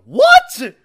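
very high, loud what; incredulous what. Real, not acted. Host scoffing at fans who only want health, in high disbelief. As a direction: squeal it in mock shock. Host mocking the fan line.

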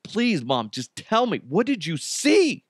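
urgent retold pleading, not shouted; begging. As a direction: act out a daughter begging, pitch climbing to the end. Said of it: "Misty is just begging the mom".

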